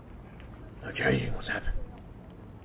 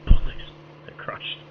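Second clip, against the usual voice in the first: whispered curse; 8 dB louder, slower than usual. Acted. Cigarette pack crushed, frustrated curse.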